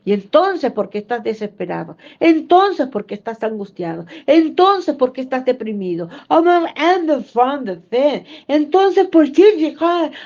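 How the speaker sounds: loud, high "Entonces" then quick question; preacher pressing believers, loud then rapid; challenging preaching. Acted. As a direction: call out the doubter.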